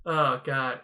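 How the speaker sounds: normal talking voice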